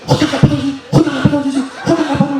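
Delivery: hissed warning to look at the door; lightly voiced wife repeating herself; wife whispering urgently. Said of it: "elle me dit".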